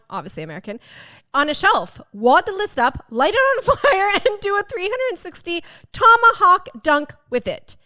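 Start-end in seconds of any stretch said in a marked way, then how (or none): 1.3-7.8 loud, high, hammed-up tirade read aloud; building mock-angry reading, easing at the end; all-out mock rant. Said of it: "He went all out".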